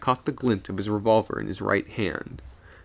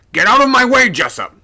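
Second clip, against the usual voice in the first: loud, much higher roar; 13 dB louder than usual. A narrator's reading. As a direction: blast him aside. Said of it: "the skipper roared".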